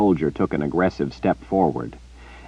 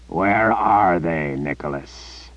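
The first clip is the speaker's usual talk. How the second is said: dangerous question; slower than usual. Acted. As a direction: let the threat hang in it. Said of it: "as dangerous sounding a question".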